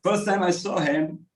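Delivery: normal talking voice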